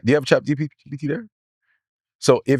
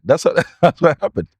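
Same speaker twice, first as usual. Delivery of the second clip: ordinary delivery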